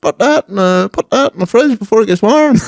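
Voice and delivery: high mock old-lady voice; confused granny. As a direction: play the muddled granny giving orders. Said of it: "She said".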